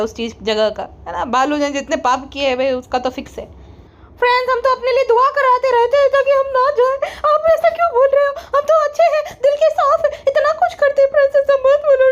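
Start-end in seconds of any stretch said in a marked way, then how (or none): 4.2-12.1 higher, sugary "friends" vlogger impression; mocking her sister-in-law's vlog voice, pitched higher. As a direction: ham up a fake-sweet influencer addressing fans.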